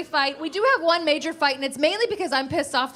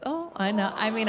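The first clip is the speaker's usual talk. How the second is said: ordinary delivery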